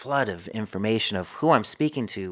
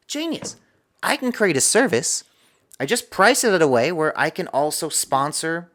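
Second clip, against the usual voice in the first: high, excited burst of inspiration; light up with a sudden idea, pitched high; retold eureka moment; 6 semitones higher, 4 dB louder than usual. Retells his sudden realisation.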